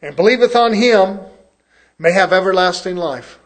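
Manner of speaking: booming pulpit scripture reading. Acted. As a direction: proclaim the verses like a preacher.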